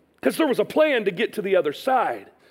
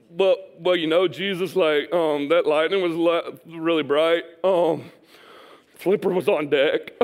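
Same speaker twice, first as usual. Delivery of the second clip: sheepish Peter; 6 semitones lower than usual. Acted. Peter hesitantly explaining himself to Jesus.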